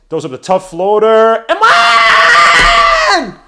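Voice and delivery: building to a huge, drawn-out shout; excited play-by-play. Real, not acted. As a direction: stretch it out, then explode on "and one". Live call of a scoring play.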